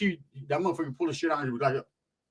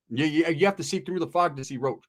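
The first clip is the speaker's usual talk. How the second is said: usual speaking voice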